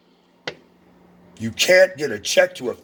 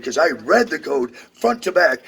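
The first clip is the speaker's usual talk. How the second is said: ordinary talk, nothing marked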